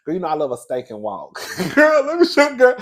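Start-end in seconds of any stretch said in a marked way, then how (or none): 1.8-2.8 high loud gush; excited. Real, not acted. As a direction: squeal it eagerly. Excited to show the clip.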